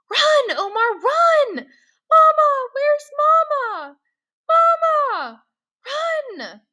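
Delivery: high, loud frantic yells; panicked shouting. Acted. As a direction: scream for Mama while running. Boy flees gunfire, calling mother.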